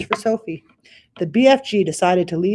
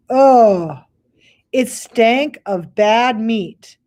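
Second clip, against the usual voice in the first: disgusted narration; 5 semitones higher, 7 dB louder, much slower than usual. A narrator's reading.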